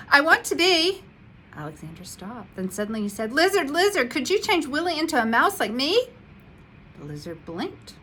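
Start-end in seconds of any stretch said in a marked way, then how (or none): 0.1-1.0 higher, louder eager start; eager wish. A narrator's reading. Mouse begins his wish. 3.3-6.1 higher, louder pleading call; eager plea. A narrator's reading. Mouse makes his wish.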